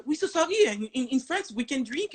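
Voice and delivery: put-on apologetic French-accented English, pitched high. Tourist excusing herself to police, high French-accented apology. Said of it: "essayez d'utiliser l'accent".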